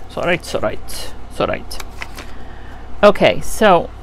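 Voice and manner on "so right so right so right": whispering. Real, not acted. Whispered agreement to herself.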